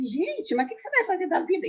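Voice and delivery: high-pitched retold disbelief; mock astonishment. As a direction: imitate people's incredulous question, pitched well up. Retold shocked reaction of everyone. (espanto imitado, em voz aguda)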